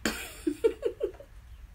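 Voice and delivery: high, unvoiced giggle; real airy laugh; breathless laugh. Real, not acted. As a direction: laugh it off in disbelief. Same laugh, repeated footage.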